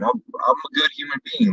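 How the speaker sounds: high, soft, showy good-guy voice; mock self-praise. Real, not acted. Telling friend he'd share the bottle, high mock innocence. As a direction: play the saint with a wink.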